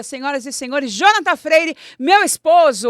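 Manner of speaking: loud announcer build-up; grand entrance announcement. Acted. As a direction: announce the guest loudly. Host introduces guest.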